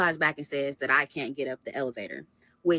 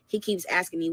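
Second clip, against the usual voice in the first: usual speaking voice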